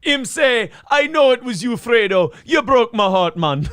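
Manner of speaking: high-pitched Jamaican patter; Jamaican accent. Acted. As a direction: put on a comic Jamaican accent. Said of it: "you should be from Jamaica".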